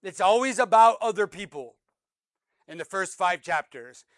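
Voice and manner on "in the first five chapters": usual speaking voice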